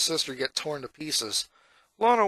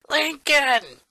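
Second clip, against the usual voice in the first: higher, louder call of his name; 10 semitones higher, 5 dB louder than usual. A narrator's reading. Lana calling to her brother, eager shout. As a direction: shout across to get his attention.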